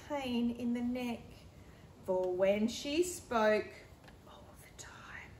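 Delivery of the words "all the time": soft breathy whisper of a story aside; hushed storyteller aside. Acted. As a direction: whisper it to the listener.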